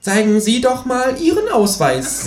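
high, pushy kangaroo voice; a cheeky high voice for the Kangaroo. A narrator's reading. Känguru nags the narrator to clean the bath, high and pushy.

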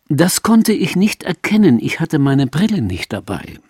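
lightly voiced cheerful mother; old mother's voice. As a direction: deliver the punchline cheerfully. Mother's punchline.

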